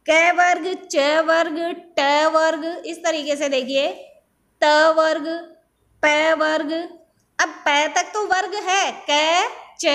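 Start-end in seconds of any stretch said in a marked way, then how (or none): 0.1-6.7 each group called out with stress; drill recitation. Shown as a demonstration. Teacher drilling pupils on letter groups, emphatic. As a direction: call out each group clearly for repeating. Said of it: "teacher drilling letter groups".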